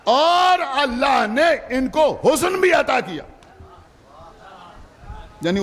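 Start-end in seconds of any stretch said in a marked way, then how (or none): 0.1-3.2 fervent preaching. Real, not acted. Preacher to the majlis gathering, fervent full-force preaching.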